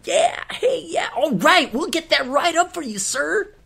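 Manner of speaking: very high, strained upbeat yell; overly peppy, clearly forced; forced cheery server. Retold or imitated. Server forced to sound fun for a customer, high and strained.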